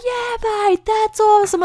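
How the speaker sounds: very high, excited holler; cheering dad. Retold or imitated. Dad across the park cheering the boy, excited holler.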